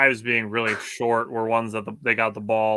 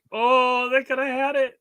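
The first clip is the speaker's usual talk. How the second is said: high, loud wail over a near miss; retold agonized lament; 13 semitones higher, 3 dB louder, slower than usual. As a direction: cry out as if reliving the near miss. Retold reaction to called-back touchdown.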